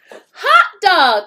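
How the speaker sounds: loud, high cheer; excited cry. Acted. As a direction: shout it out in relief. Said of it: "cried the kids".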